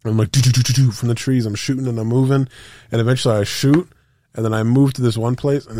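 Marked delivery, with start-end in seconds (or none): 0.3-0.9 gunfire noise. Retold or imitated. Imitating gunfire.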